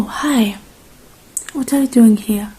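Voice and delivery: ordinary talk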